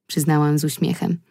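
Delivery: plain reading voice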